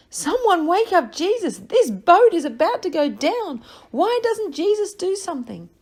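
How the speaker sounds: high, loud frightened cry; panicked shout. Acted. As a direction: yell in panic as the boat sinks. Panicked disciples in the storm.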